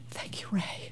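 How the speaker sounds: barely audible imitated murmur; hushed thanks. Mother to father once kids fall silent, hushed thanks. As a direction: imitate a tiny, very soft thank-you.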